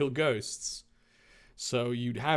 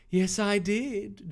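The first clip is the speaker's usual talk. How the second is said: slow, higher, put-on sultry villain; hammed-up sexy villain; 6 semitones higher, slower than usual. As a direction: purr it like a smug seductress. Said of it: "stick with the sexy voice".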